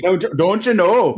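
put-on North Dakota accent. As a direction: lean into the Upper Midwest lilt. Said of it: "the North Dakotan accent".

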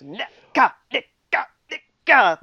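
high, loud quick nicka nicka nicka; Nicholson "nicka" tic. Retold or imitated. Friend imitating Nicholson's drunk lawyer tic, high and loud. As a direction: imitate Jack Nicholson's weird little throat sound. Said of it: "like that weird little thing he did".

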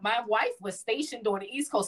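ordinary delivery

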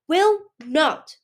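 put-on high, loud child's protest; a frightened boy refusing; outraged and scared. A narrator's reading. Boy defying the queen, high, outraged and scared. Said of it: "outraged and scared".

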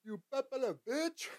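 high squeaky-rising jeer, then a laugh; mock taunt. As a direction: pitch the insult up high and giggle.

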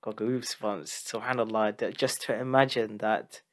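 real emotion, quiet and hushed as the story sinks in; moved, emotional. Real, not acted. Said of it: "making me emotional with that story".

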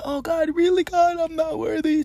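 high, tearful mock sob of gratitude; imitating a grateful happy cry. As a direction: squeak it out like overwhelmed joy tears. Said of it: "a happy cry".

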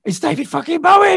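high, loud, excited disbelief; starstruck. Retold or imitated. Meeting [name] backstage, high excited disbelief. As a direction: gasp it out in awe. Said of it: "I'm thinking".

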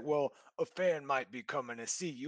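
a light parody of a lame excuse; mocking his excuse. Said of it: "he tries to come at it".

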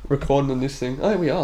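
ordinary talk, nothing marked

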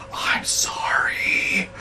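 very slow, whispered, almost crying; tiny whispered sorry. Retold or imitated. Boss whimpering an apology to the angry stranger. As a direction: whimper it out, defeated. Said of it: "literally the sound and tone of his voice".

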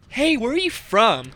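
slow, sing-song cadence on "where are you from"; lilting cadence. Retold or imitated. Imitating a Utah girl asking, "where are you from" in a slow lilt. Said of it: "Like that with a cadence".

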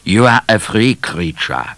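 put-on accented, dismissive explorer; miserable foreign explorer. A narrator's reading. Explorer giving way to Midnight, miserable accented voice. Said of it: "with his miserable laugh".

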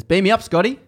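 mock-cheery surgeon quip; joking doctor. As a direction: toss off the joke lightly. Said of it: "Doctor jokingly says".

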